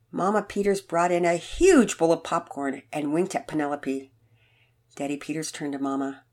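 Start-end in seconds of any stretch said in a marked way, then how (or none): none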